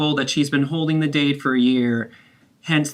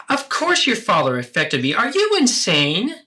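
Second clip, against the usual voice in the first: incredulous outrage; 8 semitones higher than usual. Acted. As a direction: drag it out in disbelief. Mother lashes out at daughter.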